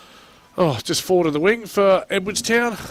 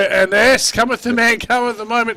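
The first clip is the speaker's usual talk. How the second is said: higher, louder, rousing proclamation; excited sports call, pitched up and loud; excited commentary; 7 semitones higher, 7 dB louder than usual. Real, not acted. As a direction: proclaim it like a big moment in the match. Calling an exciting moment of play.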